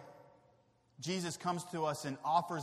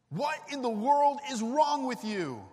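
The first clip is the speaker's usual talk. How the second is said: loud, slow exasperated scolding; comic fake misreading of the verse, loud and fed up; mock exasperated Jesus; 5 semitones higher, 7 dB louder than usual. Preacher jokingly misquotes Jesus, loud exasperated scolding. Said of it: "No, it doesn't say that".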